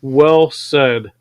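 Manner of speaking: ordinary talk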